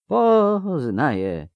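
very slow, high, stretched-out word; faint reply. Acted. Boy answering an acquaintance while fainting, slow and high. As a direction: drag it out as if about to pass out.

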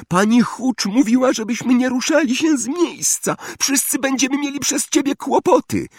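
put-on very high-pitched scolding girl; Hermione Granger crying out. A narrator's reading. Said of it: "krzyknęła Hermiona Granger".

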